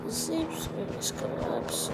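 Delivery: low, soft game announcer imitation. Echoes game announcer line.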